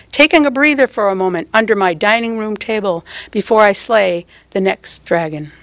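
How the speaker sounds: plain reading voice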